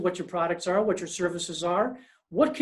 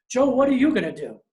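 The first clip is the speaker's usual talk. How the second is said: higher, louder challenging question; questioning salesman; 5 semitones higher, 6 dB louder than usual. Retold or imitated. Salesman pressing Joe about his own plans, raised and louder. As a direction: push the boss for an answer.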